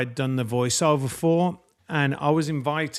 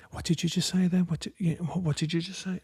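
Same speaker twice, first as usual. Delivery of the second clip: on edge; 2 semitones higher, 8 dB quieter than usual. Retold or imitated. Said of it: "he's like on edge".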